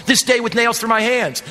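usual speaking voice

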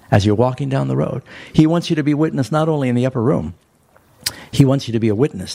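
normal talking voice

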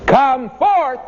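an imagined shout over the hills of Bethany; shouted call. Retold or imitated. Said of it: "shouted out across those hills".

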